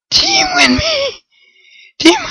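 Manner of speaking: very high whining plea; high begging cry; high pleading wail. Real, not acted. Begging teammates.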